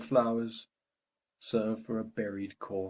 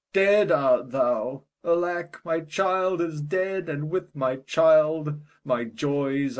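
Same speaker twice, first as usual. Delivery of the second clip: anguished wail; 3 semitones higher, 6 dB louder than usual. Acted. Father over his dead daughter, a loud anguished wail.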